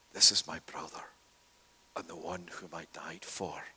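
soft hushed whisper; tender whisper. Acted. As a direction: whisper it with quiet love. Jesus defends Peter to Satan.

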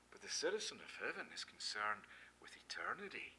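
hushed whisper for emphasis. Real, not acted. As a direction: drop to a whisper on eternity. Sermon drops to hush.

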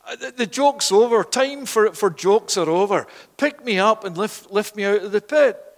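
loud, high urgent plea; pleading call. Acted. Joseph in the pit to his brothers above, urgent pleading shout. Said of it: "he's looking up at them, and he's saying".

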